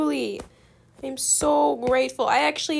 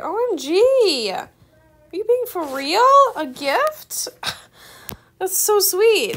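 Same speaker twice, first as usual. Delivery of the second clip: high, loud delighted gasp; thrilled at a gift; squealing surprise; excited surprise; 6 semitones higher, 7 dB louder than usual. Real, not acted. Receiving a gift pet.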